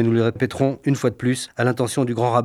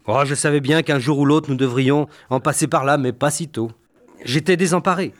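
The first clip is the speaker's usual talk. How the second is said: usual speaking voice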